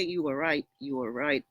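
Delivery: low, soft, agreeable murmur; soft and yielding. Shown as a demonstration. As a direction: concede it gently, low and quiet. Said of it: "soft tone".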